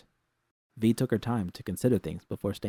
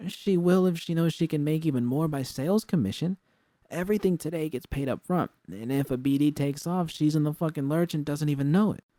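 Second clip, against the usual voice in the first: Jude's voice, higher and pressing; insistent; 6 semitones higher, 3 dB louder than usual. A narrator's reading. Jude argues with V about Susie, insistent. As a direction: argue the case, mildly frustrated.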